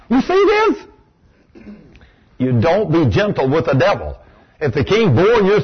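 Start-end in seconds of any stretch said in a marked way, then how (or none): 0.1-1.6 high, stretched out; excited exclaim. Real, not acted. Preacher calling to the congregation, high and excited. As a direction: cry it out high.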